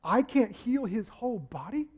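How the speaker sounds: ordinary delivery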